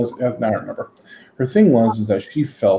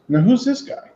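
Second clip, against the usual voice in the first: lightly imitated grandmother, high pitch; 13 semitones higher than usual. Grandmother asking about the TV painter, lightly imitated high voice. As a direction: raise the pitch for grandma's question. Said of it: "And she goes".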